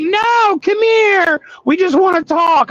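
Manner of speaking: high, loud wheedling cookie voice; coaxing cookies. Retold or imitated. Cookies coaxing the dieting trucker over, high loud wheedle. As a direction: lure him over like a pushy snack. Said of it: "they'll start hollering".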